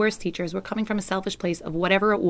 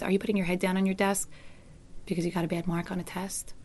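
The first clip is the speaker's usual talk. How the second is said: soft, gentle concern; teacher from a you place, soft and caring; 7 dB quieter than usual. Shown as a demonstration. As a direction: ask quietly and kindly. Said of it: "coming from a you place".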